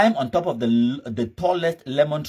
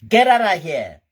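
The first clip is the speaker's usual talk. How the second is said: high, loud fake American outburst; exaggerated American accent, loud dismissal; 6 semitones higher, 8 dB louder than usual. Shown as a demonstration. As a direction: shout it in a put-on American accent. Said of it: "with my fake American accent".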